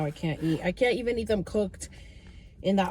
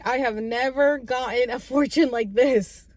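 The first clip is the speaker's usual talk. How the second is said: ordinary talk, nothing marked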